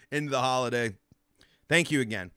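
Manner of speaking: normal talking voice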